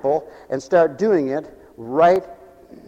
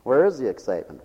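much lower, softer repeat; quiet echo; 8 semitones lower, 8 dB quieter, faster than usual. Acted. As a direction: drop to a low soft echo.

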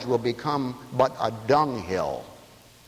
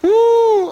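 excited whoop; 10 dB louder than usual. Real, not acted. Preacher's exuberant whoop.

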